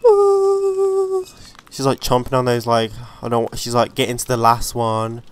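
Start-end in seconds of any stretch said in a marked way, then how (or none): 0.0-1.2 high, teasing ooh of anticipation; a sudden squealed ooh; delighted ooh. Real, not acted. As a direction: react like a gossip about to see drama. Reacting to tense sim scene.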